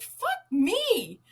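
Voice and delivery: high, drawn-up yelp of a curse; retold exasperated curse. Zookeeper shocked again by hot wire, high exasperated yelp. Acted outburst after another shock.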